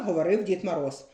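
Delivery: ordinary delivery, nothing marked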